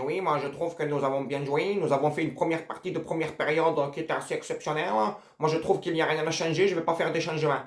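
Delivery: quiet, understated coach's interview voice; mocking Didier Deschamps. Mocking the coach's half-time interview, quietly smug. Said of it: "Il dit".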